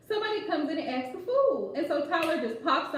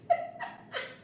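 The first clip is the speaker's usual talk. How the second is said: breathy laugh way up high; airy high giggle; 3 dB quieter than usual. Real, not acted. Host laughing at viewer's comment, airy high giggle.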